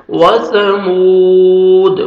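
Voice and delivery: slow chanted recitation, cut off; tajweed recitation. Shown as a demonstration. Teacher chanting the verse to demonstrate joining. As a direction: recite slowly, stop mid-word. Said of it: "ون second میم کو کھینچنا ہے".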